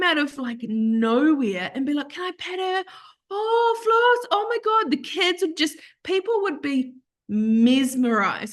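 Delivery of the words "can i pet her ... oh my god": high, squealy, breathless adoration; excited petting plea. Retold or imitated. People rushing up to Floss, squealing high with delight. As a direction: beg to pet the dog, gushing.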